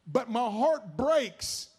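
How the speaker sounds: loud, high preaching; full-force preaching. Acted. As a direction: press the congregation hard. Preaching to congregation.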